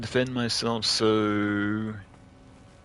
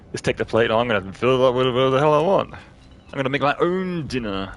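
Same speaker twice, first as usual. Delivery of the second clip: defiant; 4 semitones higher, 7 dB louder than usual. Real, not acted. Defiant rant at game.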